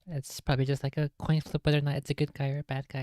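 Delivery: ordinary talk, nothing marked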